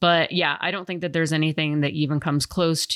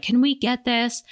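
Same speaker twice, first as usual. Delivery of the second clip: put-on much higher, pleading kid voice; childish asking voice; 9 semitones higher than usual. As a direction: ask like an eager child. Acting out a gun store visit.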